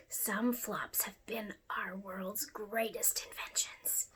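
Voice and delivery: soft unvoiced whisper to the listener; a secret shared in a hush; whispered aside. Real, not acted. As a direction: lean in and whisper it. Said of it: "whispered aside".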